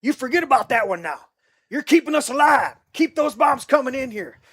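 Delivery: hammed-up combat order; battlefield shout. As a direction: call the strike in like a desperate commander. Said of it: "he's like".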